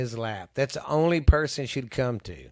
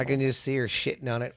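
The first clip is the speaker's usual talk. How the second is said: ordinary delivery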